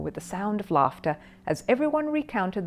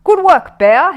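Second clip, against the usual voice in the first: much higher and louder praise; hearty exclamation; 11 semitones higher, 13 dB louder, much slower than usual. A narrator's reading. Policeman praising Paddington delightedly. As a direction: boom out the congratulation. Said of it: "he exclaimed".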